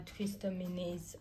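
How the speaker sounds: usual speaking voice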